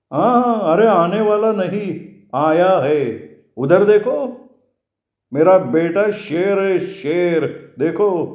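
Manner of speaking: high-pitched, delighted proud father; proud, happy ferryman. Acted. Ferryman showing students his arriving son, proud and happy.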